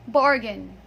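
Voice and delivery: "bargain" as "BAR-gun", first syllable stressed; correct pronunciation. Shown as a demonstration. Teacher models the word for learners, bargain said correctly. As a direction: punch BAR in "bargain", short unstressed ending. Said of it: "The correct pronunciation of that word is".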